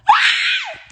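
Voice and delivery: high shrieking wail, half laughing; a sudden, shrill sob of a shout; crying shriek. Retold or imitated. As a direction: let out a high crying scream. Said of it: "I started back crying".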